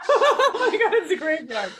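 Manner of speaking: high, loud laughter through the words; laughing hard. Real, not acted. Reacting to the clip's graveyard reveal, laughing hard. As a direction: crack up laughing as you speak. Laughing while watching the clip.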